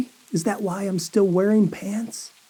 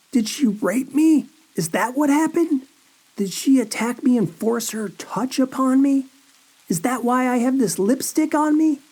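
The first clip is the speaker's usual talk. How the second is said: panicked; 7 semitones higher than usual. Acted. Panicked questions after whisper.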